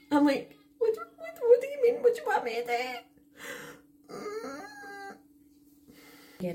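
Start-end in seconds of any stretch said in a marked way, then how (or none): 0.8-4.1 very high, tearful wail falling away; incredulous wail. Retold or imitated. Daughter replies to dad about the rat, despairing wail. Her wailed reply to dad.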